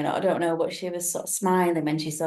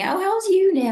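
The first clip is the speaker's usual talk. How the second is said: high, louder bright question; chirpy Chatterbox; 13 semitones higher, 7 dB louder than usual. Retold or imitated. Chatterbox quizzing the narrator about uni, high and loud. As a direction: ask it like an overeager chatterer. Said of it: "she said to me".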